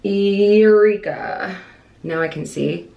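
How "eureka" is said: drawn-out laughing cry of triumph; slow, gleeful victory shout; triumphant eureka. Real, not acted. Finding contacts triumphantly.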